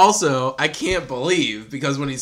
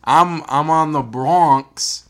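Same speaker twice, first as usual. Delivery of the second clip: slow, badly faked New York accent; horrible New York accent; 3 dB louder, slower than usual. Host keeps hamming a bad New York accent. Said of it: "That's a horrible accent".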